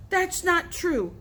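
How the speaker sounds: high, slow, insistent yell. A narrator's reading. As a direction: yell a denial. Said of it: "dripping, yelling".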